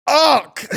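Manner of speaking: high, loud imitated "uck"; forced rhyme shout. Captain fixing his rhyme at the rally, loud high blurt. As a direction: blurt a nonsense syllable to finish the rhyme. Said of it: "that is how he fixed his rhyme".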